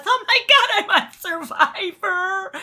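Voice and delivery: very high, hammed-up weepy squeal; mock happy crying. Man crying at being on Survivor, mock happy crying. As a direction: imitate a grown man overcome with joy. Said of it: "That's what he was crying about".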